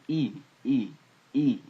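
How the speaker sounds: "E" as a clear long "ee", spaced out; E vowel sound. Shown as a demonstration. Teacher demonstrating the vowel, "E" said as a clear separate "ee". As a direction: sound out "E" as "ee", firmly each time. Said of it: "pronounce an E sound".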